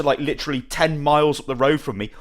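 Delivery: usual speaking voice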